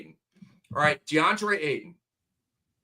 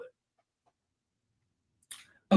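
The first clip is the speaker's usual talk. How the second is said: slow, breathy whispered sign-off; slow half-whispered wrap-up; 3 dB quieter than usual. Real, not acted. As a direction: whisper it, winding down the rant.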